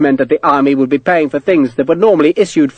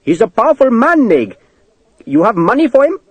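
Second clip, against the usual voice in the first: much higher clerk voice in alarm; frightened clerk; 9 semitones higher, 3 dB louder than usual. Acted. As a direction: warn the officer in a panic.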